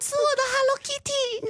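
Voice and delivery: squeaky-high mock hostess voice; ladyboy voice. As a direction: push it way up into falsetto, like a hammed-up ladyboy. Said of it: "Do it in a ladyboy voice".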